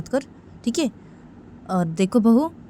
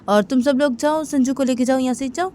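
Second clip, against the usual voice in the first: acted ordering; 7 semitones higher, 3 dB louder than usual. Father orders everyone out.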